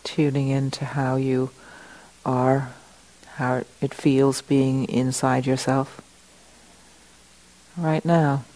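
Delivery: guided meditation. Acted. Teacher settling the group into the room, guided meditation. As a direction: settle them in gently, leaving space between phrases.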